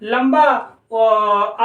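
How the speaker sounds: "लंबा" said very slowly with the vowel held; stretching the word like a teacher; drawn out. Shown as a demonstration. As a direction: hold the word out to show what long means. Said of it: "लंबा उच्चारण दिया जाता है".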